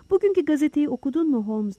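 plain reading voice

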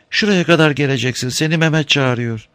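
high voiced old woman asking; timid old woman at the door; old woman's voice. A narrator's reading.

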